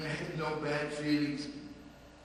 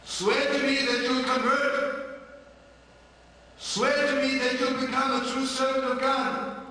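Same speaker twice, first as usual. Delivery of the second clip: high, loud, slow insistence; fervent demand; 11 semitones higher, 11 dB louder than usual. Acted. Drunkard commanding the father to return to God, loud and fervent. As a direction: hammer the oath home loudly.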